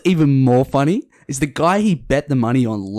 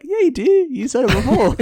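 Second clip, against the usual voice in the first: very high-pitched mock indignation; whiny drunken retort; insistent protest; 13 semitones higher, 3 dB louder than usual. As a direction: argue back, hammed up for laughs. Retold protest, played for laughs.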